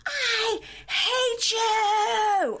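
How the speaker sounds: very high, long-drawn mock tantrum scream; screaming toddler. Retelling her screaming at him, mock toddler scream. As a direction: stretch every word like a wailing toddler. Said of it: "Like a toddler".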